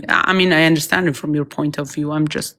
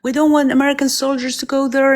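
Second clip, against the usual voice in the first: retold scream; 7 semitones higher than usual. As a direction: imitate him screaming, pitched up. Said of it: "like, screaming".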